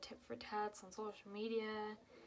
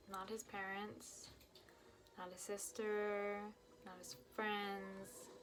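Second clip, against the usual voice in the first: each "not his" line drawn out slowly; slow emphatic list; slower than usual. Retold or imitated. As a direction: spell it out, pointedly. Slow list of who didn't call.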